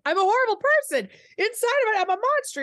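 high, rushed mock freak-out; panicked self-blame. Retelling old intrusive thoughts, mock panicked self-accusation. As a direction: act out beating yourself up, pitched right up.

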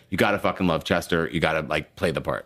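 lightly voiced cousin giving blunt advice; imitating the cousin. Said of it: "how I got this job was like".

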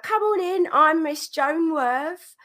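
high-pitched imitation of a cockney lady; cockney accent. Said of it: "she was real cockney".

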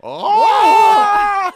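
very high, loud mock gasp-shout; outraged shriek. Comic acting the offended listeners, loud high mock shriek.

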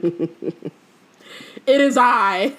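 slow, grand, drawn-out announcement with a laugh in it; hammed-up theatrical entrance; melodramatic reveal. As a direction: proclaim it like a stage villain. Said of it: "The melodrama".

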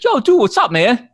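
high, excited Boston dude greeting; Boston bar buddy. Retold or imitated. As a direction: greet him like an old drinking pal, pitched high. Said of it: "he's like".

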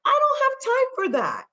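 high, loud sassy brush-off; mock exasperation. Joking about being a superpower, high sassy brush-off. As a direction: toss it off in a shrill mock huff.

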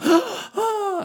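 sharp high gasp of fright; high startled gasp; mock scared gasp. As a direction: gasp like you've just seen a near miss.

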